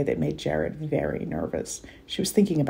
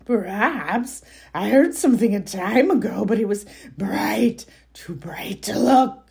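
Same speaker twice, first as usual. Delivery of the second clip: high creature voice sinking to a whisper; troll voice; 4 semitones higher than usual. Acted. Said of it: "Your troll voice is on point".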